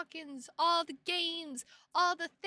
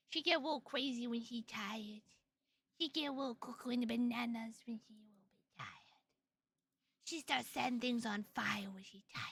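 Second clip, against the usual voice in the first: put-on soft, half-whispered baby talk; hushed, cutesy little-kid talk; 3 semitones lower, 9 dB quieter than usual. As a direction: lisp it quietly like a sleepy toddler. Baby talk about own tiredness.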